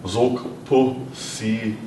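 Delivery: very slow "Zok po si"; slow demo. Shown as a demonstration. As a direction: say each syllable slowly. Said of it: "we add a little more".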